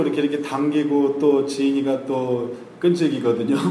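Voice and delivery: ordinary talk, nothing marked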